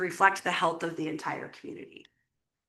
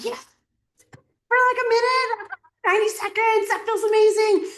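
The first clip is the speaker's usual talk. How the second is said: much higher, louder, delighted and gushing; thrilled imagining; 13 semitones higher, 5 dB louder than usual. Pretend. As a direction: gush as if the best outcome already happened. Imagining the best possible outcome.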